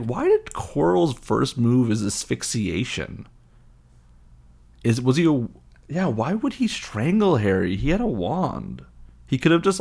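exasperated, swooping bafflement; baffled. Real, not acted. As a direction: ask in comic disbelief. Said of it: "I'm just baffled by that one".

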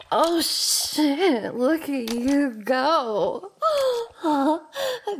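gasping, mocking laughter; laughing. Roommate laughing at him coming too soon. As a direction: laugh through it, delighted.

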